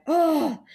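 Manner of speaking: high, loud "oh" scream; re-enacted scream. Comic re-enacting screaming alone in the car after bombing, high mock scream. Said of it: "like, screamed".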